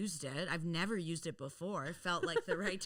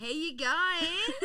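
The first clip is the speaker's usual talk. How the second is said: slow, drawn out Australian accent; 8 semitones higher, 9 dB louder, slower than usual. Shown as a demonstration. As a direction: stretch the Australian greeting, higher and louder. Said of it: "practicing my Australian accent".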